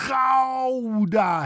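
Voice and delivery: Gouda with long throaty scrape, high and loud; exaggerated Dutch "khhow-da" for Gouda; harsh G. Shown as a demonstration. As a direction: rasp and stretch Gouda. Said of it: "the harsh G".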